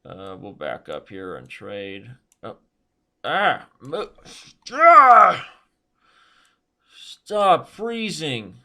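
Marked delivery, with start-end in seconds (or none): 3.2-5.4 loud, high scream of exasperation; broken yelps bursting into a scream; frustrated yell. Real, not acted. Mouse freezes, yells in frustration. 7.0-8.6 high, loud, drawn-out frustration; slow, pained scolding; exasperated plea. Real, not acted. Scolds the frozen computer.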